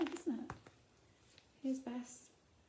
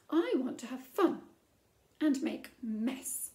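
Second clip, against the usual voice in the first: insistent; 8 dB louder than usual. Acted. Princess protests after stomping.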